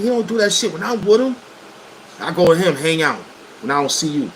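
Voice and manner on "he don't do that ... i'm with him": much higher, put-on disbelief; mock-innocent defense. Friend defending an absent man to his accuser, mock-innocent. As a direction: protest in a high, incredulous voice.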